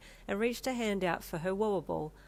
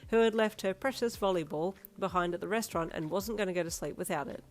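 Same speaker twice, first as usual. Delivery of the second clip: ordinary delivery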